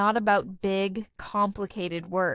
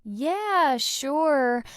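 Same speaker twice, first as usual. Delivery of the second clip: "Yeah, sure" higher, with a rise and fall; up-and-down melody; 4 semitones higher than usual. Shown as a demonstration. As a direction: give "Yeah, sure" a little singsong lift. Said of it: "the voice goes up and down with a little melody".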